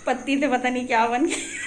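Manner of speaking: real laugh breaking in at the end; amused laughing. Real, not acted. Vlogger showing viewers her spoiled mehndi leaf, amused laughing.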